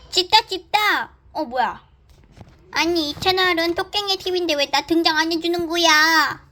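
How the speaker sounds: high, sulky complaining toy; whiny toy voice. Pretend. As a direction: pout about being left out. Second toy complains it's left out.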